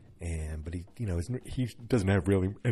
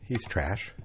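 normal talking voice

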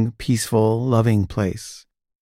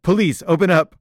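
raised, higher police call at the door; police shout; 6 semitones higher than usual. Retold or imitated. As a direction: bark it like a cop demanding entry. Police knocking loudly at door.

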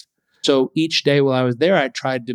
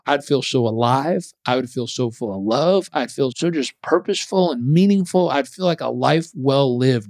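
normal talking voice

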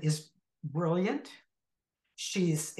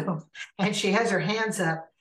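usual speaking voice

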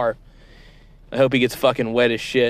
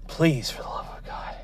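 whispered plea; 3 semitones higher, 6 dB quieter than usual. Acted. Host pleads under his breath.

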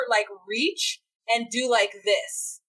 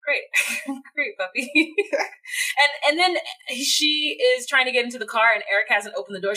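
normal talking voice

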